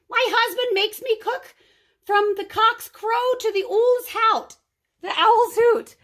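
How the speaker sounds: high, loud, put-on giantess cry; roaring giantess. A narrator's reading. Giantess begging Kate for help, high loud cry. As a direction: wail like a huge desperate woman. Giantess complains about her husband.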